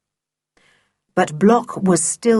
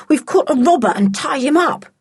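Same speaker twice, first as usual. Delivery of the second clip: panting; 8 semitones higher than usual. A narrator's reading. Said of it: "panted Sooty".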